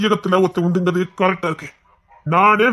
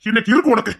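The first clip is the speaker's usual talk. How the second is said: ordinary talk, nothing marked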